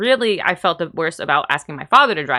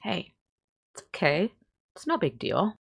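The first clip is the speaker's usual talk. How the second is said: gentle reassurance; 9 dB quieter than usual. Retold or imitated. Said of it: "He just goes".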